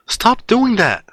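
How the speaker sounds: put-on high, exasperated girl's outburst; scolding girl. Ochako slapping Izuku to stop him, scolding in high voice.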